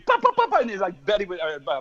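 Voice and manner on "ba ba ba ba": high ba-ba drum mimicry; scat drum beat. Mimicking the drums mid-song, pitched high. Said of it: "play the beat".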